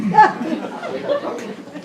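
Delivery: high, squeaky relieved giggle; kid's giddy laugh. Retold or imitated. Injured boy laughing at his mix-up, high giggly laugh. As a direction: laugh it off like nothing happened. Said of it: "and he's laughing".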